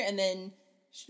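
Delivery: usual speaking voice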